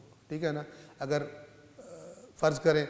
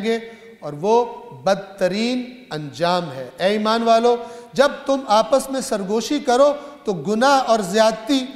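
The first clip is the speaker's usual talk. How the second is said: raised, measured voice declaiming scripture; preaching recitation; 4 semitones higher, 5 dB louder than usual. Acted. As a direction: proclaim the verse firmly to the congregation. Recites scripture translation in sermon.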